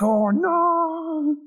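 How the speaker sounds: very high, drawn-out cartoonish wail; high-pitched mock despair; mock dismayed wail. Acts out Lego character's dismay.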